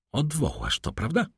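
high-pitched question with growing irritation; rising anger. A narrator's reading. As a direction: press for an answer, anger mounting. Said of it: "ze złością rosnącą".

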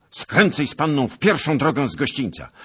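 loud, high urgent command; shouted order. A narrator's reading. Knight sending squire off with the lady, commanding shout. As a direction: shout directions over the gallop. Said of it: "krzyknął".

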